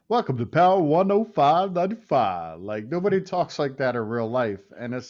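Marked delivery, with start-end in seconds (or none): 0.1-2.6 higher, louder radio DJ announcer; mock radio DJ hype; strip club DJ voice. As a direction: boom it like a club DJ. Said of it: "strip club D J voice".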